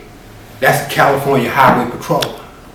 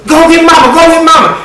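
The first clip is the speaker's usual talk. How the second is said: much higher, loud urgent command; urgent shout; 7 dB louder than usual. Acted. Marquette sending his cousin for mama, loud high shout.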